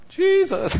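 high, loud mock call of the name. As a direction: call Jesus to wake him up, half joking. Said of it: "and I can go".

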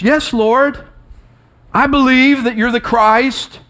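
high-pitched, fervent confession; earnest declaration. Retold or imitated. Acting out Mary's confession of faith.

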